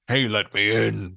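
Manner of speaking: gravelly wolf at the door; low, gravelly wolf. Acted. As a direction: growl it low like the big bad wolf. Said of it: "his voice is low and gravelly".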